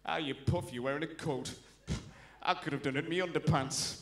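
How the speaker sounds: low Geordie voice, mildly mocking; lightly played Geordie sneering at an explorer; scornful Geordie bloke. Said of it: "the Geordie bloke would look at Scott and go".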